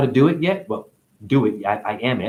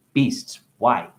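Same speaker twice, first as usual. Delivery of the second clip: ordinary talk, nothing marked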